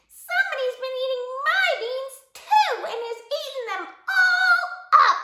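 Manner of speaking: put-on little bitty baby voice, very high; squeaky baby bear. A narrator's reading. Baby bear finds his beans gone, squeaking. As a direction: squeak it loudly like a bear cub. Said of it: "squeaked the baby bear in his little bitty baby voice".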